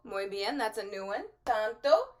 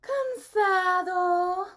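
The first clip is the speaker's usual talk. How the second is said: high, very slow, drawn-out sleepy voice; exaggerated drowsy Cansado; hammed-up sleepy; 10 semitones higher, 6 dB louder, much slower than usual. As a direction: yawn it out like a tired child. Says tired word sleepily.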